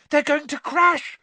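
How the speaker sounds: high, loud cry of alarm; scream. A narrator's reading. Girl sees two players diving toward the ground, screaming in alarm. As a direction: scream a warning. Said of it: "screamed Hermione".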